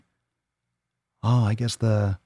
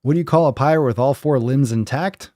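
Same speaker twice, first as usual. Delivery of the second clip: normal talking voice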